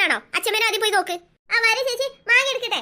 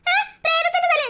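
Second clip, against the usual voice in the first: much higher, startled gasp of a question; shocked disbelief; 9 semitones higher than usual. Acted. Sister hears of the ghost's head, high shocked cry. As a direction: jump at the news.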